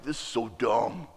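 soft grumbled complaint; mock grumpy mutter; bad attitude. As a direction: grumble it under your breath, annoyed. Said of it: "I just got this bad attitude".

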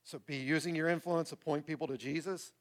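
ordinary talk

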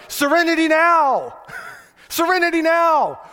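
high, loud frantic yell of serenity now; Frank Costanza yelling. Retold or imitated. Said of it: "we're a lot like Frank Costanza ... running around going".

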